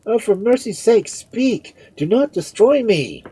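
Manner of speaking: high, loud desperate plea; anguished cry. A narrator's reading. Man begging Sir Ambrose to speak, anguished cry. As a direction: beg for mercy in anguish. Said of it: "cried he, wringing his hands in unutterable anguish".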